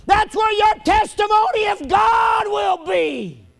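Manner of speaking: high, loud, slow preaching cry; sermon climax at full voice; preaching shout. Acted. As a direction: lean into each line and shout it.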